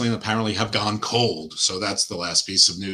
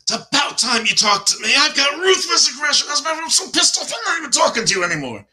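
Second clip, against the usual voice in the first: much higher, louder hammed-up rant; mock angry wrestler; 4 dB louder than usual. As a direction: squeal it out like a furious wrestler quitting. Mocking wrestler storming out at boss.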